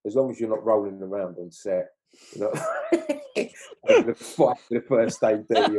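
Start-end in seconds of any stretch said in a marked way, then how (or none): 2.5-5.8 laughing. Real, not acted. Laughs at fight image.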